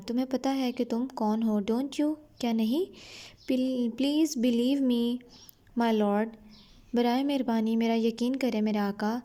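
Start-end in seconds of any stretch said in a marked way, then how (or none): 3.5-6.3 slow, careful reading of the English text; slow story reading. A narrator's reading. Teacher reading the English line slowly to her students. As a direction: read each word out slowly for the class. Reads Tom's plea slowly.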